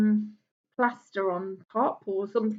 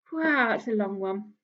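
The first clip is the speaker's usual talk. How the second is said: ordinary delivery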